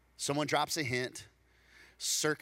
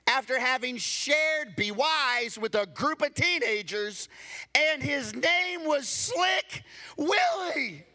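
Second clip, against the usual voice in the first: much higher and louder, slow and shouted; preaching at full force; 10 semitones higher, 6 dB louder, slower than usual. Real, not acted. Preacher booming the swindle story's punchline to the congregation.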